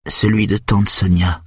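usual speaking voice

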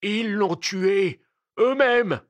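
slow, high, rising cry; insistent shout, drawn out; insistent accusation. Acted. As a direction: hammer the accusation out loudly. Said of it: "insistait Isvolgin".

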